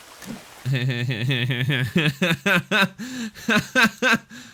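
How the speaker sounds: loud giggling laugh that climbs; long laugh. Real, not acted. Gamer after carving up a turtle, long loud laugh.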